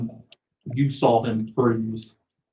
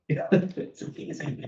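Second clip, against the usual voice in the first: whispering; 7 semitones higher, 5 dB quieter than usual. Acted. Whispered reply to Bobby.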